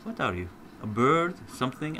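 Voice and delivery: usual speaking voice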